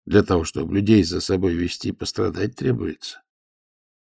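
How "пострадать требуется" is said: ordinary delivery, nothing marked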